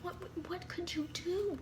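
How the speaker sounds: high whispered inner thought. Retold or imitated. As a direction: whisper what you only thought. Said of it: "we didn't say that, but we were thinking it".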